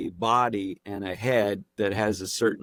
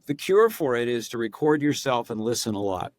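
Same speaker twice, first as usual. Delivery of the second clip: ordinary delivery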